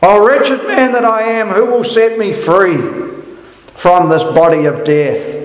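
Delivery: high, aching plea for freedom; anguished cry. Acted. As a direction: let Paul's cry for rescue ring out. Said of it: "have a cry that's with Paul".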